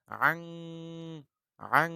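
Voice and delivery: আং hummed through the nose, loud and held; আং, nasalized ghunna sound; nasal ghunna. Shown as a demonstration. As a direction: sound আং with a long nasal ring. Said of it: "গুন্না সহকারে বলতে হবে".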